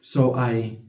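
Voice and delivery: ordinary talk, nothing marked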